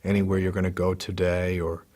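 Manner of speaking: soft, unhurried meditation voice with long pauses; calm, hushed body-scan guidance; guided meditation. Real, not acted. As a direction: guide them gently into relaxing, leaving space after each phrase.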